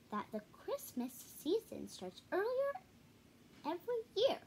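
lightly voiced fretting mother; Mama Bear. A narrator's reading. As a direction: read Mama's worry up high. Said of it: "said Mama Bear".

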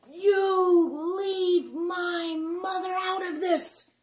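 huge, high, slow roaring shout; boy roaring at bullies who mocked his mother; roared. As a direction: roar it so the mountain rings. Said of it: "roared so loudly".

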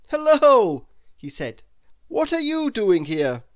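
a man surprised to find someone tiny; lightly voiced Mister Robinson. Mister Robinson greeting the tiny visitor, surprised.

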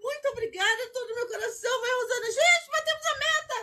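high, laughing and half crying with joy; overflowing with happy tears; celebrating the goal, high, laughing through tears; tearful joy. Real, not acted.

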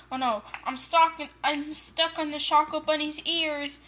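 ordinary talk

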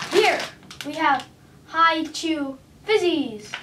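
normal talking voice

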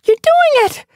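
very high, loud delighted shout; calling out. A narrator's reading. Sun Paw cheers her swimming friend, loud. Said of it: "Sun Paw called out".

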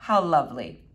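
low, deadpan echo; dry, unexcited agreement; unimpressed echo. Acted. Flora echoes her mother without enthusiasm.

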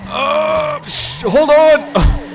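panicked yelp. Real, not acted. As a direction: cry out while being thrown off. Falling off the car.

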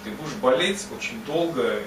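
normal talking voice

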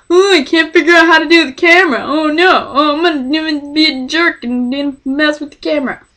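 high, put-on bumbling voice; mocking imitation. Mocking friend fumbling with the camera.

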